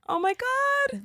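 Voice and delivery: high-pitched, moved "aww" tone; gushing, very high exclamation; touched reaction. Real, not acted. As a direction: react with your voice soaring up. Moved by friend's touching story.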